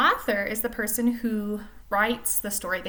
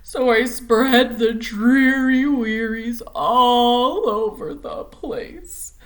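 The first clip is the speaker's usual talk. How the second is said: slow, louder, mopey singsong refrain; gloomy pout fish; 3 semitones higher, 9 dB louder, much slower than usual. Acted. Teacher reads the pout fish's gloomy refrain, slow and loud. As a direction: drag out every word like a sulking fish.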